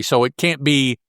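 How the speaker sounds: ordinary delivery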